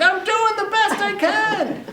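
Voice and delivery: very high, drawn-out retort; high, stretched-out defensive cry; exasperated yell. Retold or imitated. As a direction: wail it back slowly in mock exasperation. Teller yelling back, acted.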